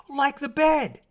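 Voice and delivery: high loud eager guess; eager mermaid. A narrator's reading. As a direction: chirp it out hopefully.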